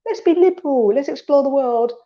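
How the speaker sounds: high, bright airy voice; playful high optimistic voicing; mock cheerful cortex. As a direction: sing it out like a carefree idealist. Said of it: "that amazing creative".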